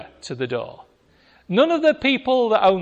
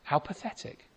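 whispered scorn; 3 semitones lower, 11 dB quieter than usual. Real, not acted. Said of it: "repeated verdict".

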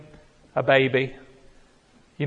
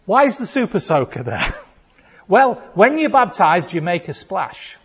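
ordinary delivery

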